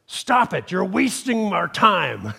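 stern teacher scolding. Retold or imitated. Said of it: "And the teacher said".

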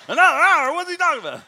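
very loud, high startled outburst; mock alarm. Imitating congregation waking up to another hour, loud high alarm. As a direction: imitate a dozer jolting awake in protest. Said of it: "some of them woke up".

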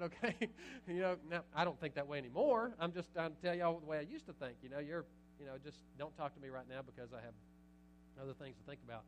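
normal talking voice

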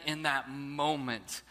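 ordinary talk

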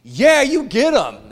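loud, slow, high cheer of approval; big drawn-out amen-style cheer; cheering them on. Retold or imitated. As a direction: egg the preacher on like a fan. I'm like.

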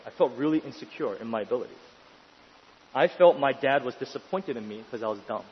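ordinary delivery

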